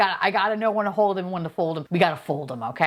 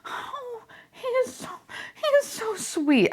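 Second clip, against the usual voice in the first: cooing; slower than usual. Real, not acted. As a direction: melt over the little figure. Cooing over fishing boy.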